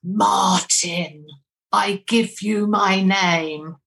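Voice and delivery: drawn-out, solemn spirit voice; ghostly man's voice. Retold or imitated. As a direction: imitate a slow, eerie voice from the background of a tape. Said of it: "you hear a man's voice saying".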